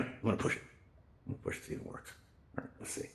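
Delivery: very quiet, half-voiced aside; muttering to self. Real, not acted. Presenter pushes at stuck slides to see if it works, muttering. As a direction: mumble under your breath while fiddling.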